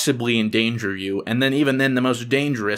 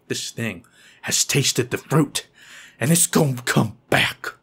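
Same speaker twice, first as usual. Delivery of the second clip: ominous warning; slower than usual. Retold or imitated. Acts out dread of spider returning.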